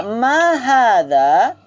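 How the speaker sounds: slow, drawn-out Arabic drill phrase; slow model for repeating. Shown as a demonstration. Teacher models the phrase slowly for students to repeat. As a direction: spell out each syllable for the class to echo. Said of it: "drill model for repeating".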